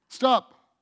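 single firm stop. Retold or imitated. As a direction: say it once and mean it. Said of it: "All I'd have to do is say".

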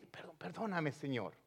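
a quiet word of repentance; soft prayer. Acted. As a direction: drop to a hush asking pardon. Voiced prayer.

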